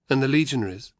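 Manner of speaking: usual speaking voice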